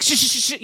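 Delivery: a string of urgent mock shushes; mock shushing. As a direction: shush the group in a hurry. Acts out hushing the group.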